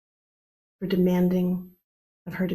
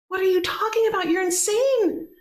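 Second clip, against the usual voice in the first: outraged disbelief; 12 semitones higher than usual. Acted. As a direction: cry out in shocked disbelief. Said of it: "Earth is like".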